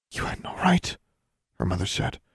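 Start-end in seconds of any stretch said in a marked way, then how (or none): 0.1-1.0 hushed, high and whispery; stunned reproach. A narrator's reading. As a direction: barely get the words out in shock. Mother reacts to being exposed.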